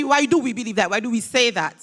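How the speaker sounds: usual speaking voice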